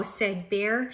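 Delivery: lively, expressive storybook reading; reading to children. Acted. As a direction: read it like story time for a class. Teacher reads picture book aloud.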